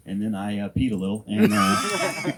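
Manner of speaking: ordinary talk, nothing marked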